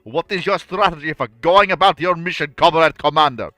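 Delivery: hammed-up Soviet admiral briefing his commander; Soviet accent. Soviet commander briefing a comrade, in a booming Russian accent. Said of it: "Best Soviet accent".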